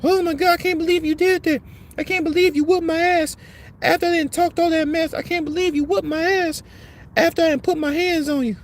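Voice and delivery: high, stunned falsetto of a beaten woman; hammed-up woozy woman whining in a high voice; mock dazed woman. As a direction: squeal it out like she can't believe it, mocking her. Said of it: "So she's out there woozy".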